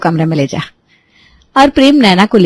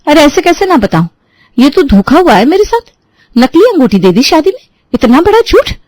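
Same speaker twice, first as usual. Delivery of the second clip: high, louder angry outburst; furious, climbing to a high peak; 8 semitones higher, 3 dB louder than usual. A narrator's reading. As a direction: lash out at being cheated. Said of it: "नैना गुस्से से कहती है".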